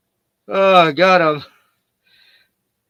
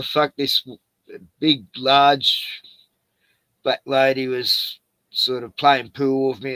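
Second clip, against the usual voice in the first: usual speaking voice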